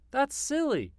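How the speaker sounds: high bleating lamb. A narrator's reading. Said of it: "bawled the lamb".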